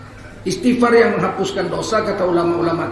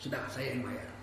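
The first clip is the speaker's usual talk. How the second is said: calm reassurance; 7 semitones lower, 17 dB quieter than usual. Acted. God voiced settling the debt.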